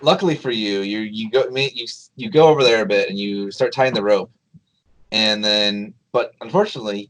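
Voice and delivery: ordinary talk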